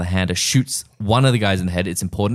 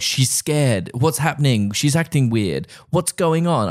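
higher-pitched fretting; mock alarm; 6 semitones higher, slower than usual. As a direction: imitate a worried doctor, voice raised in pitch. Acts out doctor watching the monitor.

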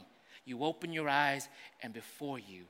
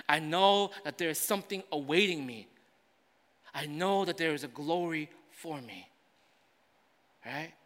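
usual speaking voice

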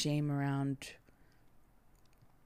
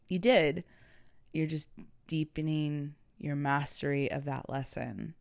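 ordinary delivery

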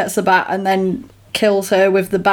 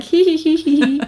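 high-pitched pretend tittering; mock sneaky giggle; 8 semitones higher than usual. Hidden recruit laughing at the fooled sergeant, sneaky giggle. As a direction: titter like someone getting away with hiding. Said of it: "And you'd be like".

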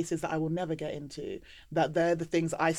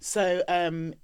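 ordinary talk

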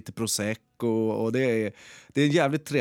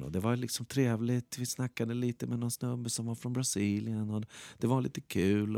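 cosy soft tone; 7 dB quieter than usual. Shown as a demonstration. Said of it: "pratat i den här tonen".

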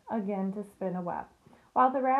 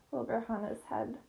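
plain reading voice

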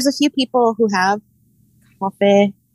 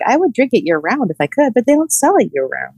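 normal talking voice

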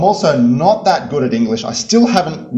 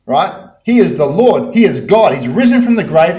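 ordinary talk, nothing marked

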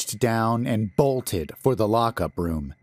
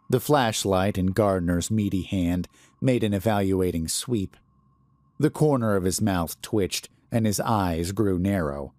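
ordinary delivery, nothing marked

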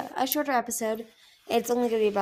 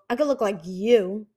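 snarled; 5 dB louder than usual. A narrator's reading. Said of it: "Icicle snarled".

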